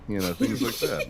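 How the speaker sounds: ordinary talk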